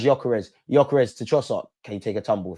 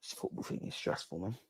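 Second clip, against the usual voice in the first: whispered under the breath; a tired stressed whisper to himself; 5 semitones lower, 18 dB quieter than usual. Real, not acted. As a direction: breathe it out, worn down by nerves. Fan mutters under breath.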